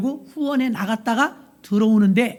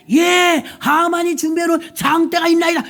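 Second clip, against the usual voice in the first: servant answer; 5 semitones higher, 3 dB louder than usual. Acted.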